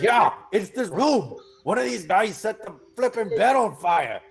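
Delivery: high, put-upon complaint; exasperated Ismark. Acted. Ismark grumbles to the party about the burning bed. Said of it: "And Ismark is like".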